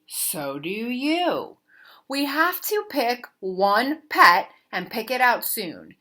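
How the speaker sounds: ordinary delivery, nothing marked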